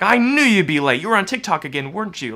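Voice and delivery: loud, high accusing wife; intense accusation. Retold or imitated. Wife attacking husband as he walks in late, loud and accusing. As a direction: pounce on him with blame. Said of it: "she could go really intensely".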